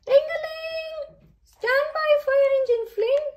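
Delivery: very high, loud storybook alarm; booming call. A narrator's reading. Rocky alerts the fire engine at the rescue centre, booming. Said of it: "boomed Rocky".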